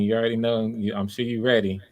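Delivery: normal talking voice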